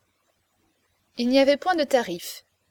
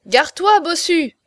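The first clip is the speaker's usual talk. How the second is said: loud, higher shouted warning; warning cry; 6 semitones higher, 7 dB louder than usual. A narrator's reading. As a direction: yell to get him out of the way. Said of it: "crièrent les agioteurs".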